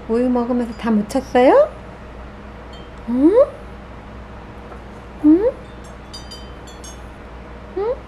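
usual speaking voice

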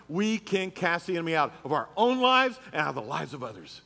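loud, driving sermon voice; preaching at full force. Acted. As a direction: preach it to the whole room. Preaching to congregation.

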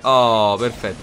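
normal talking voice

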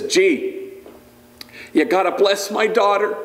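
mock homie father pleading; Bandit's voice.

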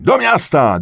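loud, high, quick shout; crowd's rallying cry. A narrator's reading. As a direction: shout the slogan briskly. (krótki, głośny okrzyk)

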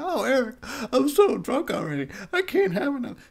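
put-on high whiny drunk wimp; mock a whining lightweight. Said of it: "I know you're like".

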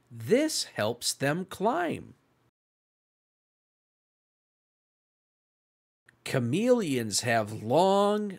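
bright, lively storytime read; picture-book reading voice for young listeners; reading to children. Acted. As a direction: read it like storytime for little ones. Reads picture book to kids.

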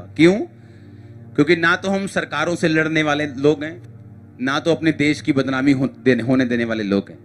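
normal talking voice

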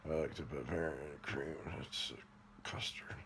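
soft whispered confession; breathy secretive whisper. Real, not acted. Whispered confession about donuts.

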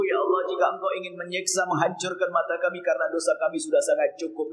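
ordinary talk

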